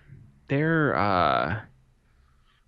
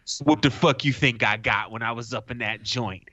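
normal talking voice

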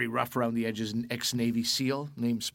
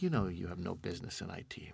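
hushed, breathy retold put-down; mock whisper; 6 semitones lower, 8 dB quieter than usual. Classmate's snide put-down, half-whispered. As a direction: drop to a near-whisper for the jab.

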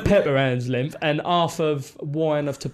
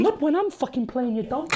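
high Welsh comeback, laughing through it; amused, high-pitched Welsh impression; Michael Flynn; 9 semitones higher, 4 dB quieter than usual. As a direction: imitate a cheeky Welsh teammate, pitched up. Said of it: "this is how he used to talk".

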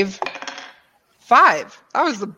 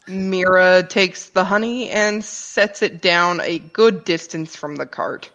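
ordinary talk, nothing marked

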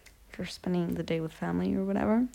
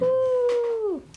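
excited cheer; 5 dB louder than usual. Real, not acted. Cheering sister's vacation news.

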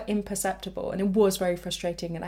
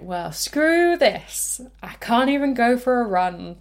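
exasperated outburst, higher and louder with a drawn-out start; fed up; 4 semitones higher, 6 dB louder, slower than usual. Retold or imitated. Runner giving up on the first run, exasperated. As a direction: throw up your hands and quit on it. Retelling her fed-up self-talk.

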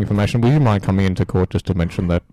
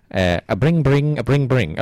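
sing-song bring-bring phone imitation; mock phone ring; 5 semitones higher, slower than usual. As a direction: chirp it like a ringtone. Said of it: "let me just see if that phone's ringing".

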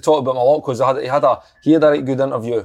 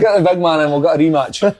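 insistent call; 5 semitones higher, 4 dB louder than usual. Real, not acted. Demanding a rematch, joking.

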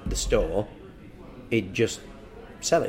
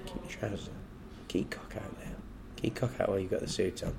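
breathy whisper, quick and urgent; 9 dB quieter, faster than usual. Retold or imitated. Girlfriend urging him in the changing room, whispered. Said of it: "she'd just sort of say".

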